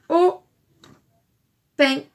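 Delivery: "au", long pause, "pain", each alone; loud "au", a gap, then "pain"; split into syllables. Shown as a demonstration. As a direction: say "au pain" as two separate syllables. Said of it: "le début de homard".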